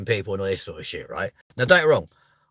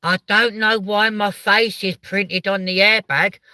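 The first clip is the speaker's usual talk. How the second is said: higher, slow, daft voice; hammed-up bewildered woman driver; mock dazed driver; 11 semitones higher, 5 dB louder, slower than usual. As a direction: play the clueless crashed driver, dragged out. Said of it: "And then they go".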